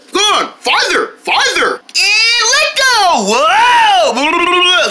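ordinary talk, nothing marked

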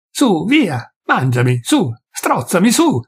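high, raging taunt; desperate fury. Acted. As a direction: dare him to kill you. Peasant's desperate outburst.

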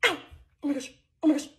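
ordinary talk

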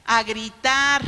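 loud punch on "gritar"; emphatic answer. Acted. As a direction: hit the answer hard and loud. Answering his own question emphatically.

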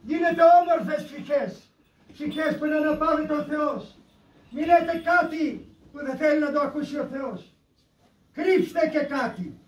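high-pitched preaching; urgent exhortation. Real, not acted. Nun urging listeners to think of their death, urgent exhortation. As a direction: raise the voice and urge the flock.

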